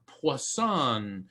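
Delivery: poisson drawn out very slowly, pwah-SOHN; stretched-out French poisson. Shown as a demonstration. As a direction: savour the word poisson slowly. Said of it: "coming to us in French".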